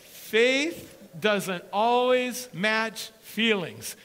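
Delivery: each word drawn out and loud; slow and deliberate. Shown as a demonstration. Preacher spelling it out to the church, slow and loud. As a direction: press every word of Faith doesn't always match feelings slowly. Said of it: "Let me make this clear".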